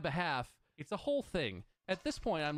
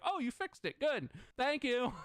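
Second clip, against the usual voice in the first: mock cheerful company; 8 semitones higher than usual. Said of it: "They're gonna be like".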